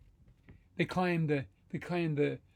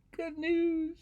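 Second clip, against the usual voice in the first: high, sung-out exclamation; gleeful storybook refrain; excited good news; 13 semitones higher, 4 dB louder than usual. Acted.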